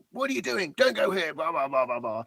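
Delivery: high-pitched mock farmer outburst, trailing off at the end; imitating angry farmer. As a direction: squeak it out like an irate farmer. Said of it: "he was like".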